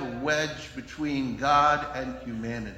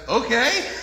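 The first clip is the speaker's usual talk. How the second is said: higher, louder, dopey okay; 7 semitones higher, 5 dB louder than usual. Retold or imitated. Adam agreeing, typical guy.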